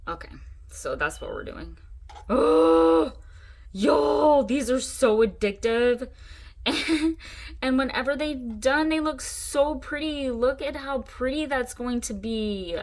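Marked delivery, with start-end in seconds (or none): 2.3-6.1 loud, high burst of excitement; thrilled outburst, slow and emphatic; excited. Real, not acted. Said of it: "I get so excited". 6.6-12.8 high-pitched, drawn-out gushing; real excitement, voice lifted high; excited. Real, not acted. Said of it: "I get so excited".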